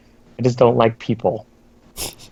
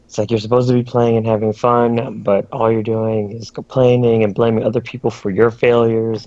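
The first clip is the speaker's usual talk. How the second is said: normal talking voice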